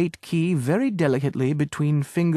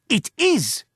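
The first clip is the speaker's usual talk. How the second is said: shouted retort; 9 semitones higher than usual. Acted. Agent insists to the owner, shouted retort. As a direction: fire it straight back. Bargaining quarrel over key.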